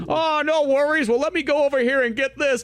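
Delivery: put-on high, unhurried cheerful old man; chirpy old fellow, up high. Imitating the old manager.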